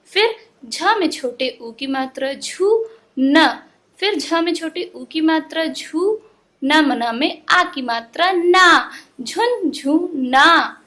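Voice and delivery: teacher's slow spelling, ending on a drawn-out "झुन झू ना". Shown as a demonstration. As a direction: slowly build "झू" and "ना" from letter and vowel sign. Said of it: "तोड़ तोड़ के पढ़ना".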